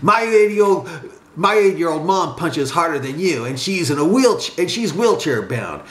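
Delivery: hooting, scornful put-down; laughing cowboy taunt. A narrator's reading. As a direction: jeer like a cowboy, stretching the final insult. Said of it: "laughed his friend like a cowboy".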